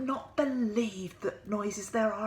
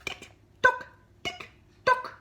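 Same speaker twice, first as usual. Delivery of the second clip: high, loud ticking tick tock; clock ticking; 6 dB louder than usual. Acted. The living room clock keeping Mister Bear awake, high ticking.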